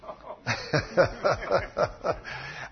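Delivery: long breathy chuckle; laugh. Real, not acted. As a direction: laugh softly at your own story. Amused by the couple's disagreement.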